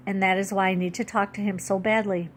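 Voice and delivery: ordinary delivery, nothing marked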